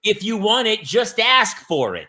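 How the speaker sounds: high, emphatic acted insistence; mock exasperation. Diner friend insists mooch just ask, mock exasperated.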